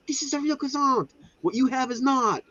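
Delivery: high-pitched mock French accent; badly faked, high French accent. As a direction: imitate a French baker with a fake accent. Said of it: "I can't do a French accent".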